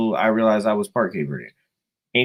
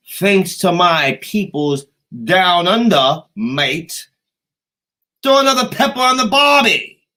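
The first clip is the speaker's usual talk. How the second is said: loud, high attempt at Australian; mock Australian phrase; 7 semitones higher, 8 dB louder than usual. Thanking his peoples down under, hollered amateur Australian. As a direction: holler a Crocodile Dundee-style Australian catchphrase. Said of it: "I can't do an Australian accent, so I'm not even gonna try. I'm just using a phrase".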